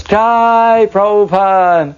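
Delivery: high, drawn-out slow cheer; crowd cheer. Retold or imitated. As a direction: cheer like a whole hall of devotees.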